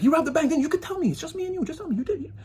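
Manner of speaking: very high, hushed conspiratorial coaxing; coaxing whisper. Retold or imitated. Neighbour prying about a bank robbery, high and hushed.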